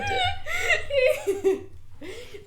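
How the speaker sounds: high-pitched squealing laughter; helpless laughter. Real, not acted. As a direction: laugh till you squeal. Laughing at co-host's verdict.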